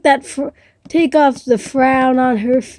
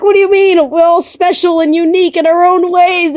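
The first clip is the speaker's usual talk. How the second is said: much higher, louder, indignant disbelief; shocked protest; 8 semitones higher, 4 dB louder than usual. Acted. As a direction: push back in outraged surprise. Spot protests B's claim.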